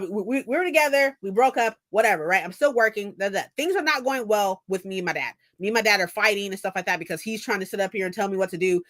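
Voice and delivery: ordinary talk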